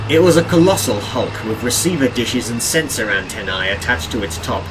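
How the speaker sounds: usual speaking voice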